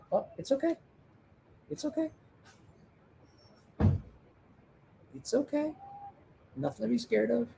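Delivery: high, soft reassuring coo; gently calm a frightened pet; soothing a scared dog. Real, not acted.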